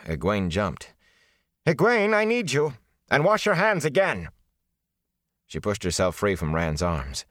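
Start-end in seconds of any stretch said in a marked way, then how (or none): 1.7-4.3 calling out. Acted. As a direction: summon her from across the yard. Nynaeve calling from the house.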